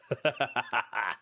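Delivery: rapid, even ha-ha-ha laugh; staccato laugh. Real, not acted. Podcast host amused by a listener's fake British persona, staccato laugh. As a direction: laugh out in a quick rattle. Reacts to reading a confession.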